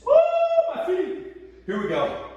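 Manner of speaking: usual speaking voice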